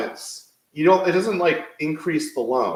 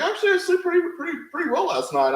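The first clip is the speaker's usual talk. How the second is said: much higher, sing-song mock of the wife; wife's quote; faster than usual. Husband mimics wife bragging she slept well, high-pitched. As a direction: lilt it up high like his rested wife. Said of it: "She's like".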